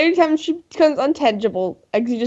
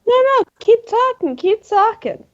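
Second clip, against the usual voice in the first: high-pitched, goading encouragement; mock urging; 8 semitones higher than usual. Player urging the director to continue, high and goading. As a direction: egg him on in a raised voice.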